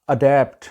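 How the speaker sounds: "اڈیپٹ" with flat "a" as in cat; correct pronunciation. Shown as a demonstration. Teacher introduces the word adapt, correct pronunciation. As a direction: say "اڈیپٹ" as "uh-DAPT". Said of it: "صحیح اس کا تلفظ ہے".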